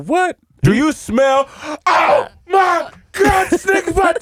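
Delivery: high, shouted, crying out in disbelief; can't believe your eyes, wailing; shocked disbelief. Retold or imitated. Incredulous retelling of The Rock.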